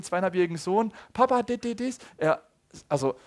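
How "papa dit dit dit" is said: imitating his toddler. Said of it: "meinen zweijährigen".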